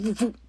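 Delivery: playful onomatopoeia for stabs; imitated knife jabs. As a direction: mimic quick jabs with your mouth. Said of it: "three little quick voop".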